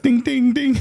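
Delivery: ping pong sound, ding ding ding, pitched high and loud. Retold or imitated. Imitating the Forrest Gump ping pong scene with high dings. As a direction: chime the dings high like a bouncing ball. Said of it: "He's like".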